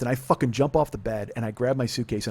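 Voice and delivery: ordinary delivery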